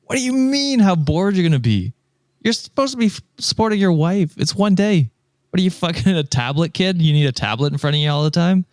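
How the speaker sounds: high-pitched disbelieving rant; incredulous scolding. Real, not acted. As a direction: tell off the husband in disbelief. Scolds the absent husband, incredulous.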